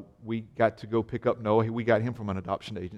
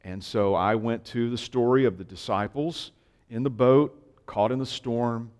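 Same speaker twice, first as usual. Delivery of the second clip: ordinary delivery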